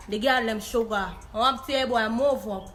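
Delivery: ordinary talk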